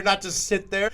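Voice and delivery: normal talking voice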